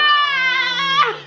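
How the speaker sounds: very high, held, crying wow; overwhelmed, cry it out; tearful wow. Real, not acted. Wife moved to tears by the husband's gift.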